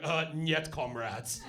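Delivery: put-on low, lightly done Russian accent; Russian in the corner correcting the Englishman, in a Russian accent. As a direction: drop into a deadpan Russian accent. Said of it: "someone's in the corner going".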